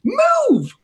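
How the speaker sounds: very high, tiny dragon squeal; adorable dragon. Acted. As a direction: squeal it like a cute little dragon. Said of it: "in the most adorable dragon voice".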